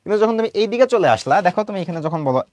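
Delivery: usual speaking voice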